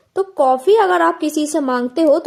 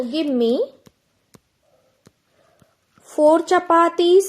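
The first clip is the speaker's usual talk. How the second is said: very slow, each word separate; slow dictation; much slower than usual. Shown as a demonstration. Teacher slowly models the right request for students. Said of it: "आप क्या बोल सकते हो?".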